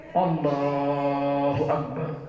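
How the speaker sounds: slow, low, soft takbir; wife starting her prayer by the bed; soft prayer opening. Retold or imitated. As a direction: intone it slowly and quietly. Wife begins night prayer.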